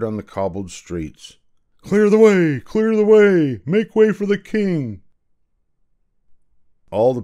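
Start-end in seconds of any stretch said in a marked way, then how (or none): 1.8-5.0 herald's shout. Acted. Guards clearing the cobbled streets for the king, shouting. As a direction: bellow down the street like a royal herald.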